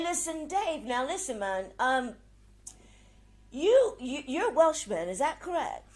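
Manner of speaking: normal talking voice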